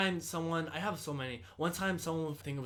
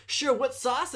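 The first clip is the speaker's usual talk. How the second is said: hyped order-taker; 10 semitones higher, 7 dB louder, slower than usual. Retold or imitated. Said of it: "extra as fuck".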